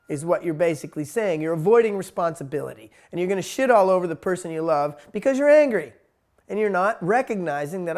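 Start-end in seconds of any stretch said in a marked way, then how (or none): none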